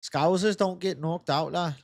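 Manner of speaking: put-on a rough stab at a Liverpool accent; Scouse accent. Streamer imitating a Liverpool fighter for chat, mock Scouse accent. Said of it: "how to do the fucking accent".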